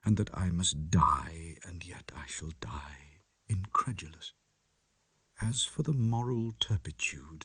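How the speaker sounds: soft unvoiced whisper; whispered dread. A narrator's reading. Lanyon facing his own death, whispered. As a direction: whisper it as if afraid to be heard.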